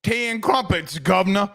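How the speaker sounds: hammed-up, high-pitched English accent. Shown as a demonstration. Host showing off his English accent after Bluey talk, hammed-up English accent. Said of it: "I can speak an English accent".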